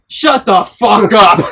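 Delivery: loud slow shout, mocking a rude student. Retelling a student yelling at the math teacher, loud slow shout. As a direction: bark it out at the teacher like the class clown.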